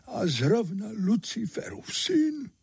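high-pitched horrified disbelief; aghast. A narrator's reading. Chimney sweep realising whose son this is, aghast. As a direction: let the voice climb in alarm. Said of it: "vyděsil se ještě víc".

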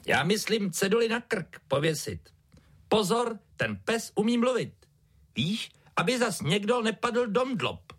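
put-on high-pitched kid explaining an idea; child character. A narrator's reading. Child answers father in story.

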